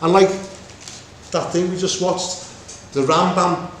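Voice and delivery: usual speaking voice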